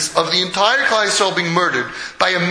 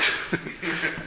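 soft breathy laugh; 3 semitones lower, 10 dB quieter than usual. Real, not acted. Laughs between repeats.

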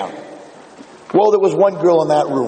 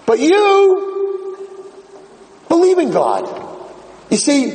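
dramatic reveal; 11 semitones higher, much slower than usual. Retold or imitated. Rabbi's dramatic revelation to girl.

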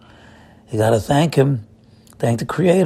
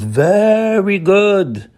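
VERY GOOD stretched long and raised high; slow, high, swelling stress on "very good"; drawn-out emphasis; 7 semitones higher, 3 dB louder, much slower than usual. Shown as a demonstration. Rabbi teaching that the world is good, drawn-out emphasis.

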